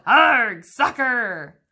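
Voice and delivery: loud, gruff-toned Arg bellow; captain yelling while kicking the ball; pirate yell. A narrator's reading. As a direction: holler as you kick the ball. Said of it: "kicked the ball and yelled".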